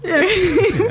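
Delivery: laugh. Real, not acted. Host teases her about butt crack.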